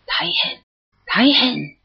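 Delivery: high breathless alarm, starting in a whisper; a startled ogre gasping out a panicked alarm; panicked cry. Acted. Fleeing ogre fearing its eye is lost: high panicked cry. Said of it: "びっくりして".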